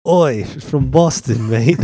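slow, loud mock accent that drifts British; hammed-up Boston accent, slow; bad Boston accent. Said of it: "do your Boston accent, [name]".

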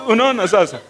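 usual speaking voice

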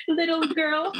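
laughing breathlessly while repeating; amused echo through a laugh. Real, not acted. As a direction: giggle the words out high.

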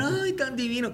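high-pitched mock gushing; imitating women swooning over a proposal; imitated swooning women. Voices the women watching the proposal.

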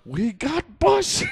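high, drawn-out triumphant shout; movie-quote cheer. Acted. Quoting Revenge of the Nerds to friends, gleeful shout. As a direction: holler it like a gleeful movie line. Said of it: "Remember in Revenge of the Nerds?".

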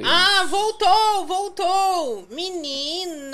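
agudo, lento e mais alto; alívio empolgado. Real, not acted. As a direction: comemorar que a live voltou. Relief when the stream comes back. (alívio exagerado porque a live voltou)

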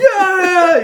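high, loud mock cheer; hammed-up cheering yeah; crowd cheer. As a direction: whoop like an excited party crowd. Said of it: "they're all just like".

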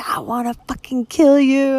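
high, loud angry yell; screaming. Real, not acted. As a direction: scream it at him, all the rage out. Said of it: "screaming at him in Spanish".

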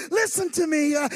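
loud, high fervent preacher's shout; preaching at full force. Acted. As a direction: build the congregation up, shouting.

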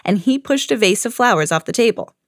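usual speaking voice

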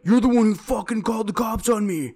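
mimicking an angry stranger; crackhead's accusation. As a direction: throw his accusation back at him. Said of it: "And he's like".

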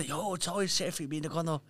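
lightly voiced leech shrugging off the king; mock-casual excuse from a leech. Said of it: "wie so Blutegel so kommen, so".

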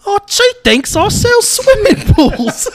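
very high, loud, slow caricature; over-the-top squeal. Acted. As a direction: blow the punchline up into a shrieked joke. Absurd over-the-top take, both laugh.

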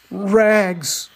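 high, loud, slow street cry; the bleeding ragman crying out his trade, loud and high. Acted. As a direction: call it out long and high, a peddler's wail. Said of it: "cried the sobbing, bleeding".

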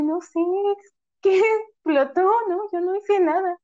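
high-pitched playing dumb; feigned innocence. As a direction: act innocent to mom. Retold denial to her mother.

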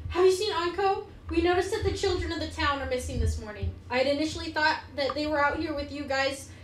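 worried town mayor. Acted. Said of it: "she comes up and she goes".

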